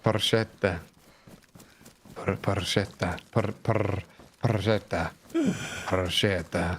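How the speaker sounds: "Pancetta" rolled slowly in a mock Italian accent, pan-CHET-ta; hammed-up Italian accent. Shown as a demonstration. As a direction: savour "Pancetta" like an Italian chef, softly. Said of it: "Say it in your normal, spicy Italian accent".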